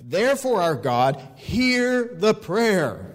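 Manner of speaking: high, drawn-out plea to God; slow, impassioned cry to heaven; crying out in prayer. Retold or imitated. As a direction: plead upward, stretching each word. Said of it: "he cries out to him".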